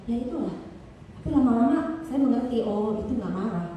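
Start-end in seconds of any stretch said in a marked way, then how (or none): none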